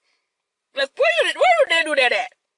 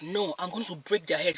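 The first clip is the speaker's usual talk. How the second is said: much softer, a hushed grandma threat; 5 semitones lower, 9 dB quieter than usual. Retold or imitated. Grandma vowing to fight thieves, hushed. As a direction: mutter the threat low so nobody hears. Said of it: "we're whispering all this while".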